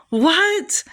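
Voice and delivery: high, startled squeak of a question; acted-out high-pitched astonishment; startled disbelief. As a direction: imitate someone completely taken aback. Acts out Tim's startled reply.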